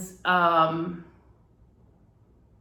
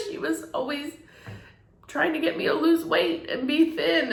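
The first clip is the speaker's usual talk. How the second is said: tearful, higher voice with long halting pauses; trying not to cry; 6 semitones higher than usual. Real, not acted. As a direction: keep talking through real tears, stopping to regain control. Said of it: "I'm trying not to cry".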